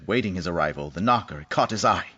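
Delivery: ordinary talk, nothing marked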